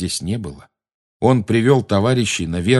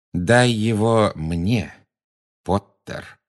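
slow and deliberate; much slower than usual. A narrator's reading. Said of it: "с расстановкой повторил голос".

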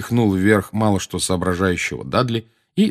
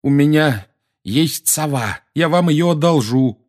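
strained, higher voice under a load; laboured, raised voice; groaning; 8 semitones higher, 4 dB louder than usual. A narrator's reading. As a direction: groan it out with effort. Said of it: "простонал Гарри".

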